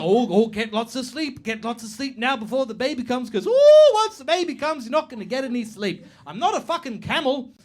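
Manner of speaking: lightly hammed-up stranger doling out advice; mock advice-giver. Imitating unwanted advice givers.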